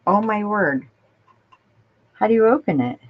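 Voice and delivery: fighting a lid that won't budge; opening a stuck jar. Real, not acted.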